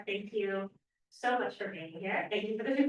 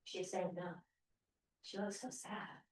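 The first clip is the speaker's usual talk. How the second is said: ordinary delivery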